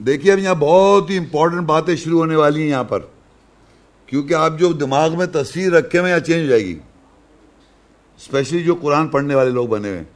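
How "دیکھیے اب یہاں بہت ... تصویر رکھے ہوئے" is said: usual speaking voice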